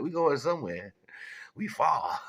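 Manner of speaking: put-on high, loud childlike protest; insisting kid. Child argues back, acted.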